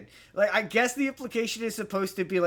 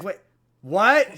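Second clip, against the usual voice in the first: surprised; 3 semitones higher, 9 dB louder than usual. Retold or imitated. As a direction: blurt it out in disbelief. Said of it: "it's like".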